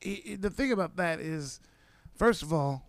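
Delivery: ordinary delivery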